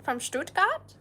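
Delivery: questioning in a put-on German accent. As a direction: ask it like a German local. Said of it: "I can do a German accent too".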